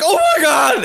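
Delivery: high, loud panicked "Oh my God"; panicked shout. Retold or imitated. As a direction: shout it in horror. Luke panicking on air.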